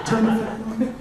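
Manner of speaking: ordinary delivery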